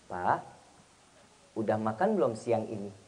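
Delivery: soft, low, tender question; gentle wife. Acted. Wife checking on husband's lunch, gently and softly. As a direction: ask lovingly in a hushed low voice. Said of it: "Tergantung intonasi, nada".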